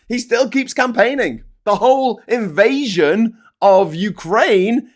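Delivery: high, loud angry rant; heated rant. Real, not acted. Venting about leaders who never stop, angry loud rant. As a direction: rail against them at full pitch. Ranting about Putin and Netanyahu.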